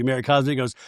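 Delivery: ordinary talk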